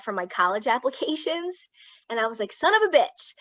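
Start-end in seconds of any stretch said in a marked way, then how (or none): 2.5-3.3 higher-pitched mock outburst; retold exclamation. Daughter recalling her stolen money, exclaiming.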